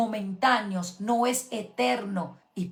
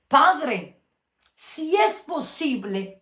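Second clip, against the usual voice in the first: louder, higher, drawn-out prayer to the Father; anguished plea; 4 semitones higher, 5 dB louder, much slower than usual. Retold or imitated. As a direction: cry out to the Father slowly, begging. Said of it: "la oración cambia y dice".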